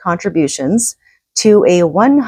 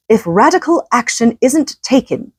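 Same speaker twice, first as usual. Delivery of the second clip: higher, put-on English accent; 6 semitones higher than usual. Host quoting Johnson's UN address in an English accent. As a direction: open the quote in an English accent. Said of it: "I'm gonna do my English accent again".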